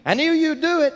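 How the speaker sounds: put-on high, certain child's voice; little boy's voice. Son telling his father he knew, high and sure. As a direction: sound like a small boy sure of his dad. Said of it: "He said, Daddy".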